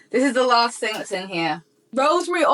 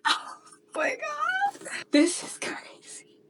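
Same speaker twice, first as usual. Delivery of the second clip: softer, breathless whispered awe; awed whisper; 8 dB quieter, slower than usual. Real, not acted. Overwhelmed by the full collection, a softer awed whisper. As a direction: hush it like it's too good to be true.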